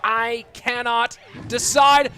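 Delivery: excited shout. Real, not acted. Climax of the leak battle.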